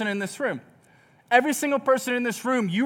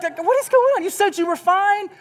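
mock exasperation; 10 semitones higher than usual. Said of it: "I'm like".

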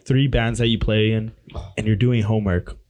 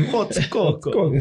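slow, higher, hammed-up Russian accent on Hot Coco; mock Russian accent; 6 semitones higher, slower than usual. Friend jokes the nickname sounds Russian, slow, high mock Russian accent. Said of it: "It sounds like a Russian".

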